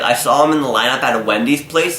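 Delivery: normal talking voice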